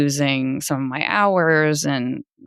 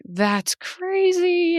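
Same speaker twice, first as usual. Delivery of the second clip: very high, drawn-out mock astonishment; imitated amazement; much slower than usual. Daughter imitating parents hearing the twin news, amazed. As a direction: stretch it out slowly, pitched way up.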